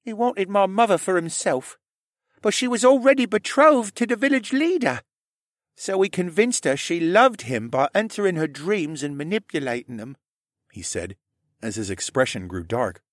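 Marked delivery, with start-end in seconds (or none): 0.0-10.2 put-on high young character voice; high-pitched, earnest tale-telling; Rylan, shy confessing stable boy. A narrator's reading.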